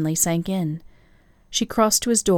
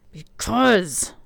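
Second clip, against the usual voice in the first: a rough, growling Because; growled; 4 semitones higher than usual. A narrator's reading. Hunter warning Alessandra off, growling. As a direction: growl the word Because at her. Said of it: "he growled".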